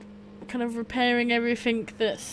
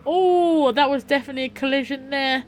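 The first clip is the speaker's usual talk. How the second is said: surprised; 4 semitones higher, 6 dB louder than usual. Real, not acted. Sees a crash live.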